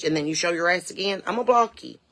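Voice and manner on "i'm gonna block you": threatening. Acted. Threat after repeated warnings.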